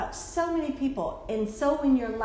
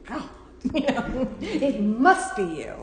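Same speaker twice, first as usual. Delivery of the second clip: mock blame, laughing; slower than usual. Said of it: "It's like".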